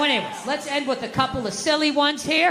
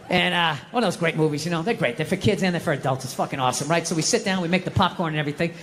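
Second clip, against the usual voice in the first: normal talking voice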